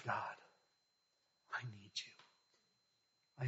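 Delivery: very soft, very slow plea; hushed prayer. Pretend. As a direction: barely breathe it to God. Intimate prayer voiced softly.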